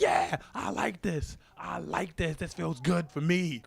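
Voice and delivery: angry woman impression. Imitating the woman in the video, loud slow mock voice. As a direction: stretch the words out, high and forceful. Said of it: "She was just angry as shit".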